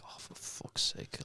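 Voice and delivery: breathless whispered oh for fuck's sake; whispered curse. Retold or imitated. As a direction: hiss it under your breath in the cubicle. Someone enters the toilet stall.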